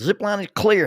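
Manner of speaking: high, loud call back; yelled reply. Retold or imitated. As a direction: shout it up from the ground. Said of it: "And they yelled".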